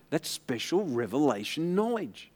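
normal talking voice

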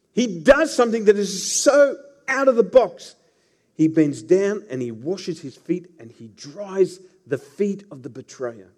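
ordinary talk, nothing marked

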